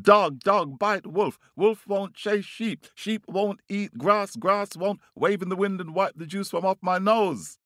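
very high, insistent bird voice; calling out, pleading. Acted. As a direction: rattle off the demand in a high chant. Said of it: "he calls out to the dog".

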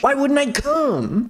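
high, drawn-out character voice; acted indignant question. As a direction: pitch it way up and stretch it. Acting Nick's line in story.

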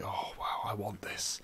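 soft, near-whispered imitation of longing; hushed awe. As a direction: breathe it out in acted wonder. Voicing awe at a broom.

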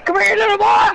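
high, loud taunt at a fleeing enemy; taunting shout. Real, not acted. Gamer chasing an opponent, shouting a taunt. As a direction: call him out like a playground bully.

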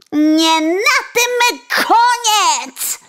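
high, loud, slow threat; sparrow's cry. Acted. Sparrow threatening the coachman, high and loud. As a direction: call it out as a vow of revenge. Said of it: "zawołał wróbel".

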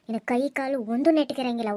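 ordinary delivery

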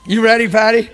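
high, loud laughing question; laughing call. Real, not acted. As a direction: tease her with a laugh. Playful laughing call to Patty.

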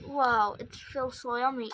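usual speaking voice

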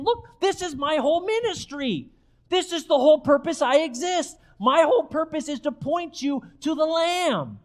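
high-pitched pulpit fervor; impassioned preaching. Acted. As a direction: proclaim a life's purpose, stretching the last word. Preaches in John the Baptist's words.

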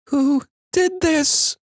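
put-on very high, slow small-child voice; tiny high voice, slowly drawn out; quiet little-sister voice. A narrator's reading. Three-year-old sister finding Lammy's head, quiet high voice. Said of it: "she let out a quiet".

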